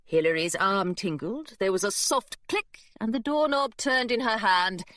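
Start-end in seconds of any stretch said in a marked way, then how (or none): none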